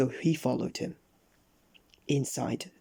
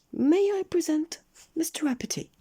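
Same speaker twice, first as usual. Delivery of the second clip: very high squeaky presentation; announcing goblin; 3 dB louder than usual. A narrator's reading. Goblin presenting Mister Uppity to the king, high squeak. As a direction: present him formally in a tiny squeak. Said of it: "announced the goblin".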